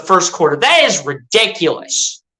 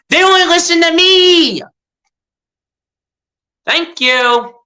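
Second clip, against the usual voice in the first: gloating boast; 9 semitones higher than usual. Real, not acted. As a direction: crow that only he motivates them. Boasting fans listen to him.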